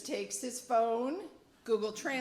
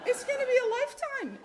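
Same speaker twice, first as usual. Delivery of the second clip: high, loud and fast, laughing; storyteller laughing at her own shock; laughing exaggeration; 7 dB louder, faster than usual. Real, not acted. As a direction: burst out with it, still reeling.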